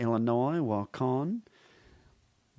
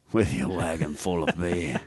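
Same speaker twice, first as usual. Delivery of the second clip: [name] impression; 7 semitones lower than usual. Shown as a demonstration. Comedian showing his new impression to hosts, sneering rocker. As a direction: lower sneering rock-star growl, dropping low. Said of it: "working a lot on, uh, [name]".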